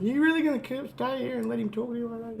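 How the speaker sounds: very high, squeaky imitated disbelief; mock indignant challenge. Co-host voicing Sammy defending him, high mock indignation.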